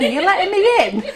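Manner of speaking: high, loud, laughing plea to be let in; impatient staff member outside. Retold or imitated. Staff member stuck outside asking to be let in, impatient and laughing. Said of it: "like, knocking on the door again".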